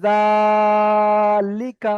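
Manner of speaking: "জালিকা" with a very long, level jaa and short lika; drawn-out syllable. Shown as a demonstration. Teacher shows students which part to stretch, long jaa in "জালিকা". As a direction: stretch the first syllable of "জালিকা" loud and steady, then release. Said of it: "আমি এটাই টান করবো".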